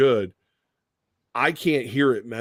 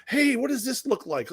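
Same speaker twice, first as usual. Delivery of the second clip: much higher, eager party-guest voice; pestering party guest; 12 semitones higher than usual. Retold or imitated. Party guest asking a doctor for free advice, pestering. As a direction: pipe up like a nosy guest.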